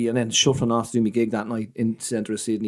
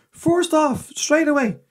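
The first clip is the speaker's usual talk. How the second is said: imitating angry dad; 12 semitones higher than usual. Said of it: "Like this, right?".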